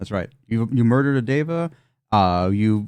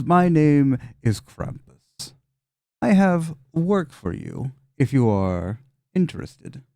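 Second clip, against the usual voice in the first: slow, higher, drawn-out demon voice; Krampus voice; 4 semitones higher, slower than usual. Acted. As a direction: speak slowly like a sly devil.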